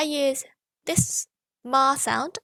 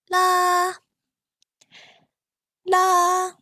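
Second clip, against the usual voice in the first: high, louder la; high pitch la; 7 semitones higher, 6 dB louder than usual. Shown as a demonstration. Teacher finding the high pitch for the fourth tone. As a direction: ring out a high level la. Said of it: "locate your high pitch".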